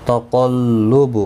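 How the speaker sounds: slow تقلب with a heavy قاف; tajweed demo. Shown as a demonstration. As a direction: recite تقلب slowly, full heavy قاف. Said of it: "قاف حروف مستعلیہ ہے، پر پڑا جائے گا".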